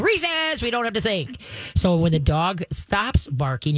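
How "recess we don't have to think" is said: sudden high, giddy voice; like a kid let out for recess; excited kid. Pretend. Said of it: "It's like saying".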